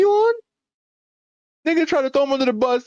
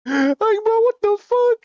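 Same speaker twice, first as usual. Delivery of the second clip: exasperated; 12 semitones higher, slower than usual. Real, not acted. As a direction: throw up your hands in disbelief. Exasperated at Elon.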